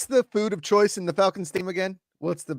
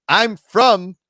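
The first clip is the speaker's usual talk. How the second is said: indignant retort; 7 semitones higher, 7 dB louder, much slower than usual. Retold or imitated. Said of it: "He's like".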